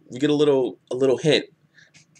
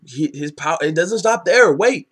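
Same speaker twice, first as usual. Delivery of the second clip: excited; 6 semitones higher, 5 dB louder than usual. Real, not acted. Hyped recap of manga power.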